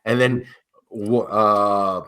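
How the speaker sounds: ordinary delivery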